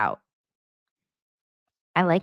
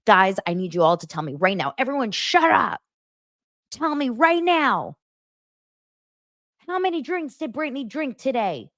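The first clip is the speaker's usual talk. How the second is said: mock-furious rant climbing much higher; high-pitched retold outburst; imitated angry demand; 8 semitones higher than usual. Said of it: "he's pissed about Brittany".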